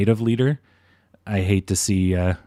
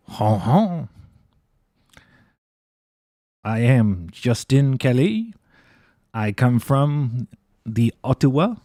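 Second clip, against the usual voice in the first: slow, hammed-up fake French accent; a clumsy French accent done for a laugh; mock French accent; slower than usual. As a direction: put on a bad joke French accent, slowly. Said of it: "I can't do it".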